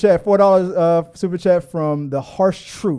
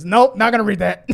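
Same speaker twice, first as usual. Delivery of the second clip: brusque, louder cut-off; mock curt host; 4 semitones higher, 6 dB louder than usual. Retelling the host shutting down a chat question, mock curt. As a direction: slam the door on the question. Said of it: "Just interrupted him mid-sentence".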